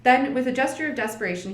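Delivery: ordinary delivery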